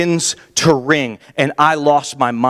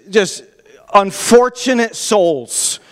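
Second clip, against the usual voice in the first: ordinary delivery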